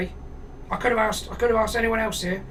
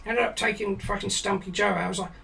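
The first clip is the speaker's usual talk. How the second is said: normal talking voice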